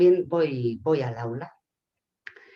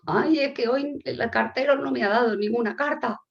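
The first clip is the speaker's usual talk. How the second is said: higher-pitched plaintive complaint; upset concierge; 7 semitones higher, 3 dB louder than usual. Acted. Concierge complaining about the postman, acted upset whine. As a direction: moan about being let down. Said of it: "Está triste y enfadada".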